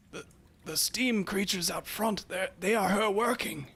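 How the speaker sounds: put-on high, halting voice of a worried old man; urgent, high-pitched old man's plea; pleading old attendant. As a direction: report anxiously, stumbling over the words, pitched high. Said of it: "you send a message and you hear back".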